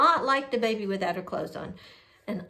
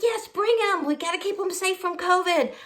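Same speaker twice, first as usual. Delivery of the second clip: higher-pitched retold insistence; eager agreement; 7 semitones higher than usual. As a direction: play up an urgent, willing yes.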